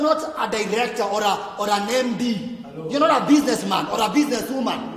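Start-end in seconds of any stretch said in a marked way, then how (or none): 2.9-5.0 emphatic preaching. Acted. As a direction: drive the point home to the church. Preacher stresses his point.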